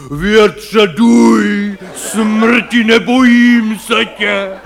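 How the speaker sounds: theatrical bombast, laughing at death; hammed-up heroic roar. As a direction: play the ham actor defying death at the top of his lungs. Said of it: "prostě řvou".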